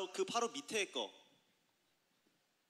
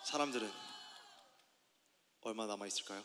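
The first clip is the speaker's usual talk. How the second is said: lightly lowered, serious movie-line voice; lowered deep voice; 9 semitones lower, 7 dB quieter than usual. Pretend. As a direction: drop the voice for a dramatic quote. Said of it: "그럼 기호가 한번 해보죠".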